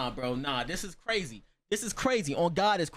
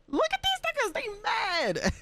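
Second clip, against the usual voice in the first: much higher, laughing taunt; high-pitched gleeful mockery; high mocking voice. Real, not acted. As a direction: squeal it out in glee. Laughing at angry classmates.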